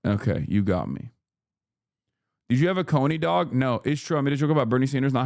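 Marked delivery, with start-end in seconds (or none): none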